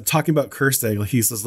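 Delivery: ordinary talk, nothing marked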